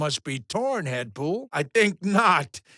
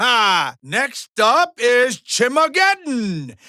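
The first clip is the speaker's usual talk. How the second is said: showman announcement; 4 semitones higher, 6 dB louder, slower than usual. Acted. Host hypes next event.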